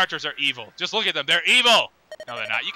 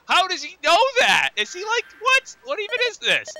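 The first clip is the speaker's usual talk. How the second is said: much higher, squeaky and incredulous; squeaky mock bafflement; 12 semitones higher than usual. Player mocking the old man's knowledge, squeaky disbelief.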